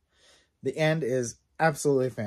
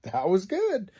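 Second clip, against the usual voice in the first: higher, louder, pleasantly surprised; impressed; 7 semitones higher, 3 dB louder, slower than usual. Retold or imitated. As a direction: sound genuinely impressed. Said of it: "I was like".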